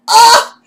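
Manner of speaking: high loud startled shriek. Real, not acted. Reacting to the Honey Boo Boo question with a shriek. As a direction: let out a sudden shriek.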